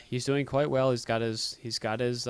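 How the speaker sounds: ordinary talk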